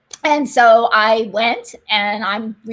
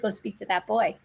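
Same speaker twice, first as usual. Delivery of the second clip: quiet divine voice; 8 dB quieter than usual. Retold or imitated. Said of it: "he said".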